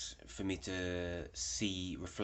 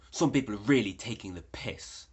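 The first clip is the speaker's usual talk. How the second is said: louder, higher grumble; imitating an annoyed muttered complaint; 3 semitones higher, 9 dB louder than usual. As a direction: snipe it as you walk off. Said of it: "he went".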